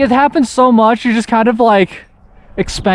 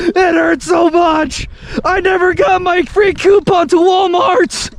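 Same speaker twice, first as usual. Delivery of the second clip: dying soldier; 9 semitones higher than usual. Acted. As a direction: overact a fatal wound. Playing a mock dying soldier.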